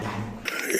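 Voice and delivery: breathy unvoiced aside; whisper. Real, not acted. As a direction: whisper it to yourself.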